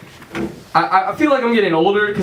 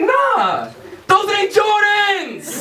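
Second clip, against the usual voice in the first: mock shout; 10 semitones higher, much slower than usual. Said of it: "He's like".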